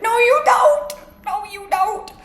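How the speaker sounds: very high, squeaky-voiced denial; mock retort. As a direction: deny it in a put-on high voice. Imagined retort to man in aisle.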